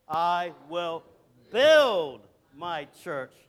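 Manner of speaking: pause, then hit and stretch "build"; stress on "build". Shown as a demonstration. Preacher leading congregation in Jesus' words, stress on "build". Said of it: "we're gonna emphasize each word different".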